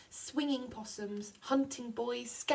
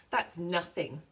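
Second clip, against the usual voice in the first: ordinary delivery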